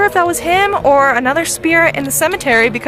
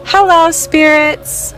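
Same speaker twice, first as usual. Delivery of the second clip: slow, drawn-out spirit greeting; 4 semitones higher, 3 dB louder, much slower than usual. Real, not acted. Starting an EVP recording, calling out.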